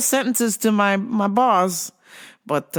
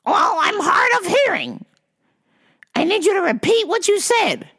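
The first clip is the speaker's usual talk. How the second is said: put-on demanding old caller; 13 semitones higher than usual. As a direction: drag it out like a stubborn caller. Caller insists.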